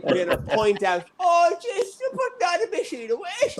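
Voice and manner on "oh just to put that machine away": high-pitched imitation of a mother; mock mum delighting in her own dig; gleeful mother. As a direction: put on a high, amused mammy voice. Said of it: "Getting so much pleasure as well".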